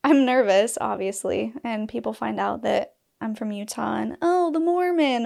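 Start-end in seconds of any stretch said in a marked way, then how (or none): 4.2-5.2 mocking. Mocking people who label her.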